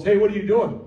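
mock yell. Someone catching the disciples untying colts, yelling. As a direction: call out to stop them. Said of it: "somebody yelled".

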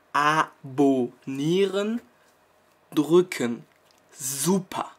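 very slow, drawn-out Abonnieren with a rising end; slowly spelled out. Shown as a demonstration. Vlogger slowly explaining to viewers who don't understand, very slow. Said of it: "ich werde es nochmal langsam für euch sagen".

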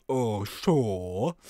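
low put-on character voice; Bobo. Bomb seller agreeing to questions, low character voice. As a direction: drop the pitch for a game character. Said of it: "he has to talk a little bit like this".

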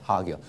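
hagios with weight on "ha", tail dropping away; stress on first syllable. Shown as a demonstration. Teacher shows stress at the front, first syllable stressed. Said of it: "所以重音在前面".

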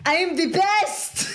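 high-pitched, hammed-up boast; imitated victory shout. Podcaster imitating Jean-Claude after knocking out a fighter, mock shout. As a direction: crow it like a triumphant fighter.

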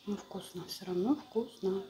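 talking while chewing; muffled through food; mouth partly full, chewing. Real, not acted. Seen 1: Woman chews between bites; lips working, dumpling on fork.

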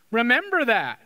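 ordinary delivery

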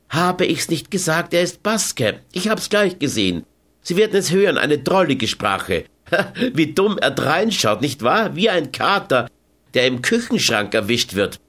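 light, playful scorn, a touch of laughter; Carmen, mocking and amused. A narrator's reading.

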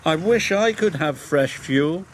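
mildly longing, with understated regret; sighing. A narrator's reading. Thomas feels left out over fuel, wistful. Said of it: "sighed Thomas".

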